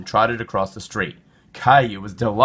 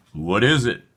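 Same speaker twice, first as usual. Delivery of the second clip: plain reading voice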